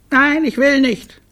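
put-on very high, loud little-kid refusal; stubborn small child. Littlest refusing to greet the director, high and stubborn. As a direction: squeal it like a defiant toddler.

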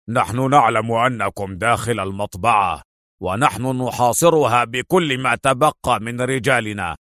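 villain's ultimatum over loudspeakers; severe, measured announcement in an altered voice; stern, angry Andre. A narrator's reading. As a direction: order a surrender with stern authority. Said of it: "وهو يقول في غضب وصرامة".

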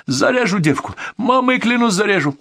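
high-pitched frantic threatening; crazed threat. A narrator's reading. Bandit threatens to cut the hostage, high and crazed. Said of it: "Глаза грузина светились безумием".

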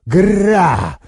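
short, high, furious animal growl; angry growl. A narrator's reading. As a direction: roar it out like an enraged werewolf. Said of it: "гневно рыкнул Арнольд".

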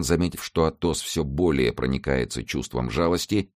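ordinary delivery

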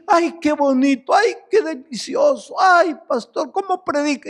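high, swooning parody of flattering fans; mock gushing churchgoer. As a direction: pastor mocking a flattering congregant, high and gushing.

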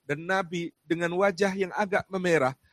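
preaching with rising fervour, loud and high; rousing sermon. Acted. Preacher stirring the congregation with the companions' story. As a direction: build it up like a preacher painting the scene.

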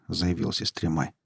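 usual speaking voice

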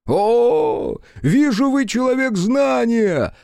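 high, loud delighted cry; delighted exclaim. Acted. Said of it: "восторженно воскликнула Аяшики". (восторженный возглас)

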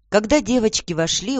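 ordinary delivery

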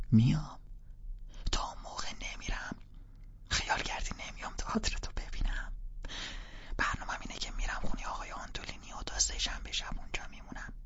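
urgent hushed whisper. Acted. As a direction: keep it down, parents are home.

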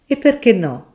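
suddenly livened up by the wine; cheerful. Acted. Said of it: "rispose il professore allegro".

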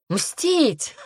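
high, incredulous echo of мстить; scornful echo. A narrator's reading. Demoness scoffing at the heroine's promise, incredulous echo. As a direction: throw мстить back as a ridiculous idea. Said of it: "расхохоталась демоница".

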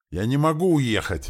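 loud, high cry of protest; desperate shout. A narrator's reading. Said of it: "закричал Шади".

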